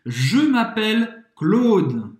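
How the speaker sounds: slow, careful "Je m'appelle Claude" with a closed O in Claude; pronunciation demo. Shown as a demonstration. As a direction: say "Je m'appelle Claude" slowly, every sound clear. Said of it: "Como é a pronúncia?". (pronúncia lenta e cuidadosa de "Je m'appelle Claude")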